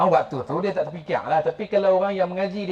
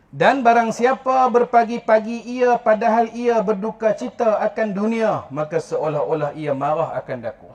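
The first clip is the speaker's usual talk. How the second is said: slow, louder, measured reading of the sacred text; solemn recitation; 2 semitones higher, 4 dB louder than usual. Acted. Teacher reciting a hadith to the mothers, slow and solemn. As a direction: intone each phrase slowly, like scripture.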